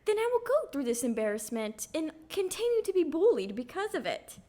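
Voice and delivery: high-pitched self-mocking lovesick quote; mock devotion. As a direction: play the doting, self-sacrificing sweetheart, hammed up. Acting out her devoted younger self.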